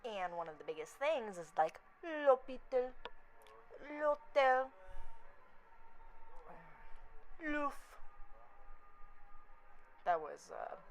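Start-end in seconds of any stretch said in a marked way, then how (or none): 2.0-7.7 "l'hôpital", "l'hôtel", "l'ouf" said the French way with silent h; higher, isolated French "l'hôpital", "l'hôtel", "l'ouf"; French pronunciation. Shown as a demonstration. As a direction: demonstrate "l'hôpital", "l'hôtel", "l'ouf" as French words, article run into the vowel. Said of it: "pronounce French words properly".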